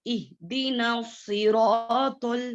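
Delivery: सिरातुल with a heavy, full ta; slow careful सिरातुल, ta said thick. Shown as a demonstration. Quran teacher showing pupils a thick ta in सिरातुल. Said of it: "मोटा पढ़ेंगे".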